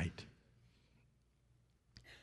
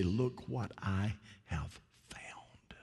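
whisper; 2 semitones lower, 10 dB quieter, slower than usual. Acted. Preacher voicing a convert's words.

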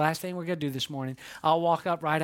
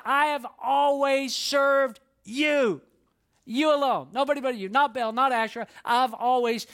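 a little attitude; 6 semitones higher, 3 dB louder than usual. Acted. Elijah answering God with a bit of attitude. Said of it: "He had a little attitude".

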